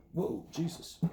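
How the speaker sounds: laughing whoa. Real, not acted. Catching himself mid-lecture, laughing it off. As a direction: laugh at getting carried away. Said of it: "Getting carried away".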